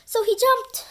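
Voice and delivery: high, loud dramatic burst; big storybook exclaim. A narrator's reading. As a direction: announce the leap with a jolt. Dramatic climax of the fall.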